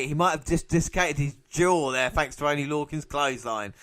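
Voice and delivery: ordinary talk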